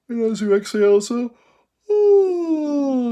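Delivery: high, loud yawning sigh sliding down. Shown as a demonstration. Voice teacher demonstrating a yawn sigh, high falling sigh. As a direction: sigh it out like a big yawn from the top. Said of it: "let's do a couple of yawn sighs".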